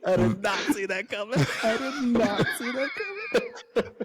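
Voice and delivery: high-pitched, helpless laughter through the words; laughing. Real, not acted. Reacting to a friend's surprise accent, laughing. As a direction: crack up while talking, pitch way up.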